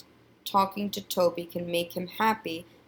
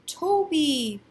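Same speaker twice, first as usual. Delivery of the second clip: high, happy exclamation of a name; delighted greeting; 9 semitones higher, 4 dB louder than usual. A narrator's reading. Boy hearing his old friend on the phone, delighted. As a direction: call out the name with pleased surprise.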